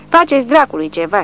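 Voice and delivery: high, loud, beside himself; Barcelona yelling at the others, furious; furious shout. A narrator's reading. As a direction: yell it out of control. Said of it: "strigă Barcelona ieșit din fire".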